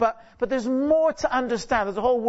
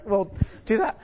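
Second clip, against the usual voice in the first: ordinary talk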